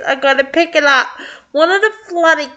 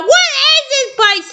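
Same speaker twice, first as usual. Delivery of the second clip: high yelled question; yelling; 13 semitones higher than usual. Acted. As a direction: shout it over the blaring alarm. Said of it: "Just for yelling".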